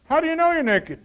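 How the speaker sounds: God calling Adam out, loud and stern; God's stern question. Acted. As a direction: confront him like an angry father.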